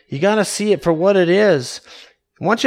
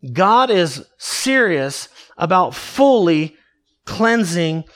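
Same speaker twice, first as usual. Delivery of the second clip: normal talking voice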